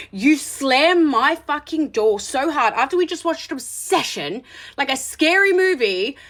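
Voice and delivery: high, emphatic outraged rant; indignant scolding. Real, not acted. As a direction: tell him off, stretching the stressed words. Scolds the absent date over door.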